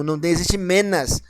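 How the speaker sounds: normal talking voice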